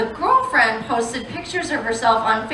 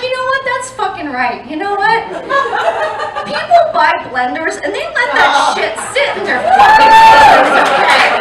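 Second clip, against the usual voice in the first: rising much higher and louder to kitchens; hammed-up indignation; 10 semitones higher, 4 dB louder than usual. Comic telling her friend about blenders, mock rant. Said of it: "And I was like".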